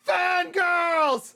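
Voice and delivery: very high, loud yell; long, high panicked shout; terrified warning scream. Acted. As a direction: scream the alarm in cartoon horror. Character screams warning of fangirls.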